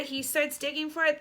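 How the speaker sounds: usual speaking voice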